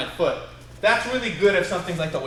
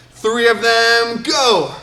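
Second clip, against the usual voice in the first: loud, drawn-out, higher starting call; calling out commands; 5 semitones higher, 8 dB louder, much slower than usual. Shown as a demonstration. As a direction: send the class off with a shout.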